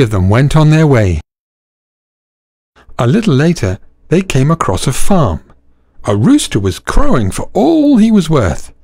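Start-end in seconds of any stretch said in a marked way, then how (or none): none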